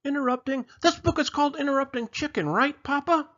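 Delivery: high, excited little chicken; a small chick eager to start. Acted. Chicken announcing the book to Papa, high and eager.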